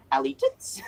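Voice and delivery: high squeaky punchline; mock squeaky joke. Streamer mocking fans, squeaky high punchline. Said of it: "five hours later".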